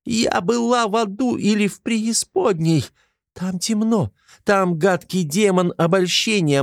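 put-on a terrified girl half-howling her story; hysterical wailing. A narrator's reading. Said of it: "истерично подвывая".

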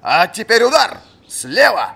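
high, loud drill command; barked command. A narrator's reading. Fencing master ordering the captain to strike.

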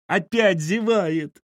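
slow, high, loud wail; wailing excitedly. A narrator's reading. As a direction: draw the cry out in wonder. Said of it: "запричитали в один голос деды". (протяжно причитая, высоко и громко)

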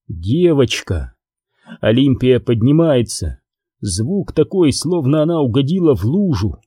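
ordinary delivery, nothing marked

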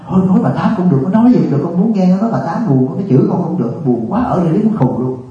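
mock plea, desperate for any company; lonely old woman. Lonely nursing home resident begs monk for gossiping visitors, imitated high plea. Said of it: "con buồn quá mà con trong hỏi tao nói".

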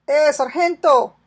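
loud, slow call across the field; shout. Acted. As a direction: hail the sergeant from afar, full voice. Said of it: "gritó el soldado".

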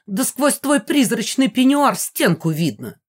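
outraged insult flung at a rival; indignant. A narrator's reading. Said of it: "возмутилась мама".

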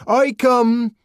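high, loud declaiming, cut off; ringing, impassioned cry; proclamation. A narrator's reading. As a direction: begin a rousing speech to a crowd. Savage's proclamation continues, broken off.

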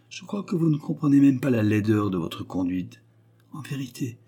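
ordinary delivery, nothing marked

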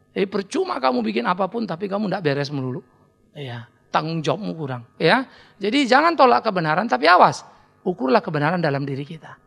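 ordinary talk

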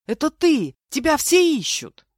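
high, loud astonished outburst; excited yelling. A narrator's reading. Heroine telling the missing man he is wanted, yelling. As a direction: cry it out at full pitch. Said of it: "заорала я".

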